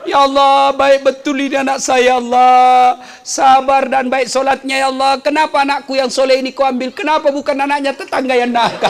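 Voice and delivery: half-hearted mock wailing to God; mock lament. Preacher imitating a mother lamenting her dead son, mock lament. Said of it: "tidak boleh meratap".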